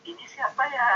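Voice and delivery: a hint of someone in love; lovestruck. Shown as a demonstration. Person in love reading a message, lightly smitten. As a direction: ask ini siapa ya sweetly, smitten. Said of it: "orang yang sedang jatuh cinta".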